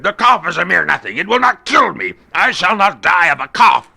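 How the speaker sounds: put-on proud drunk waving off concern; Fortunato insisting. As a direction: brush off the worry, loudly. Fortunato's dialogue voiced.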